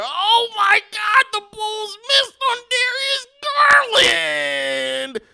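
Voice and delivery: mock-wailing fan, high and stretched out; annoying Twitter fan. Bulls fan lamenting a missed draft pick, mock wail. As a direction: cry it out slowly in a high voice. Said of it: "They go".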